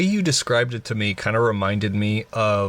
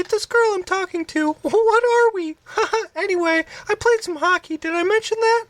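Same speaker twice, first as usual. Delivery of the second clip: squeaky Mickey Mouse voice; very high cartoon mouse voice; impression of Kevin. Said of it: "Let's do an impression real quick".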